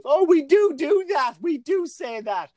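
lightly voiced high, slow woman heckling; a hint of a shrill heckler, dragged out slowly; loud woman heckler. Imitating a front-row woman heckling the comic, slow and high. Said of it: "and she goes".

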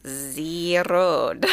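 put-on drawn-out "ze road" in Austrian accent; mock Austrian accent. Answering the remark that it sounds Austrian, mock Austrian accent. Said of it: "sounds a little Austrian".

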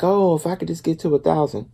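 high, loud put-on wishful voice; imitating a friend. Imitating a friend wishing for a thousand subscribers, high and wistful. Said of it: "she was like".

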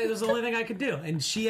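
ordinary delivery

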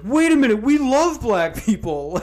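high mock backpedaling league; mock NFL voice. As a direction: squeal it like a caught-out league. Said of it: "the N F L is like".

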